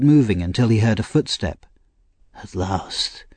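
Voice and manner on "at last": whispered. Acted. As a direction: breathe it out, barely voiced. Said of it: "he whispered".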